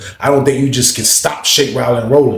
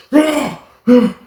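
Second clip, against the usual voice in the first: mock groans; 11 semitones higher than usual. Lawrence on the ground.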